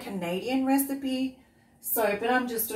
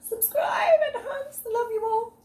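gushing squeal; 5 dB louder than usual. Real, not acted. Gushing at new viewers.

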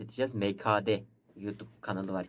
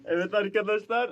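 excited; 10 semitones higher, 3 dB louder than usual. Real, not acted. Excited video opener.

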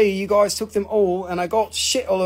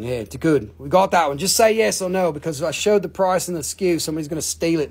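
usual speaking voice

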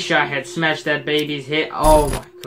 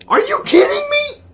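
much higher, crying-out shout; furious shriek. Real, not acted. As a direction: scream at the board game in rage. Sent back yet again, raging.

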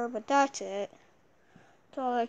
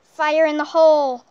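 loud shouted battle call; battle cry; 3 semitones higher, 13 dB louder than usual. Acted. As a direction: bellow it out as you charge.

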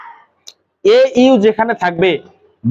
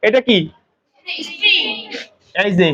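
ordinary talk, nothing marked

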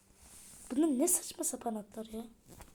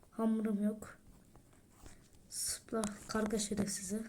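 ordinary talk

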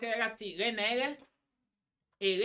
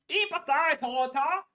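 put-on German accent. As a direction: bark orders in German accent. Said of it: "adesso inizia a fare pure tedesco".

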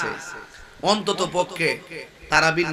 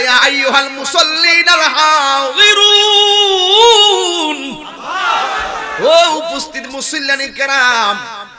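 high, long-drawn sung summons; calling out; 10 semitones higher, 9 dB louder, slower than usual. Acted. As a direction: call the congregation, stretching the last word. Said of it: "ডাক দিয়া বলে". (উঁচু সুরে টেনে মুসল্লিদের ডাক)

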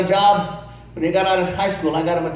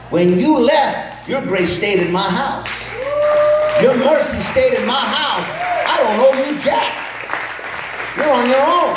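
preacher scolding son about blessings; preaching; 8 semitones higher than usual. Acted. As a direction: preach it out with full fervor. Preaching at full force.

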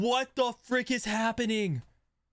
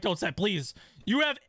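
ordinary delivery

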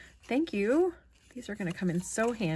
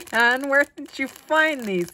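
laughing, louder and higher; 6 semitones higher, 9 dB louder than usual. Real, not acted. Amused by a friend's cheeky buttons, laughing. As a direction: giggle through the question.

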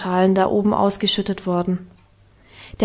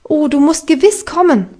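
höher, lauter Ruf über die Wiese; von weitem gerufen; 5 semitones higher, 5 dB louder than usual. A narrator's reading. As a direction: ruf es Klara aus der Ferne zu. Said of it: "rief es ihr schon von weitem zu". (Heidi ruft Klara von weitem aufgeregt zu)

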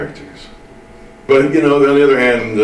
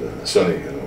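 ordinary delivery

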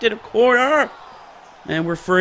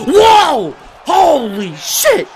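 high loud whoa, then drawn-out swearing; stunned shout; 8 semitones higher, 6 dB louder, much slower than usual. Real, not acted. Gamer stunned by a big hit, amazed shout. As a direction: shout in amazement, stretching the words.

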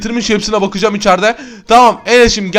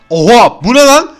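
shocked outburst; 2 semitones higher, 5 dB louder, slower than usual. Real, not acted. Host sees the guest's strange bag, shocked and louder. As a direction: blurt it out in disbelief.

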